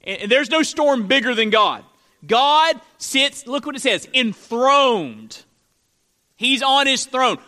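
emphatic pulpit proclamation; forceful preaching. Acted. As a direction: preach it out, leaning hard on key words.

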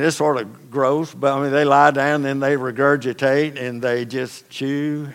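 ordinary delivery

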